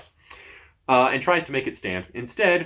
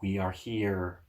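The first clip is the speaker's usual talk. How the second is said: slow, softer chorus of small voices; tiny voices; 3 semitones lower, 6 dB quieter, slower than usual. Acted. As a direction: answer gently from hiding. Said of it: "answer many tiny voices".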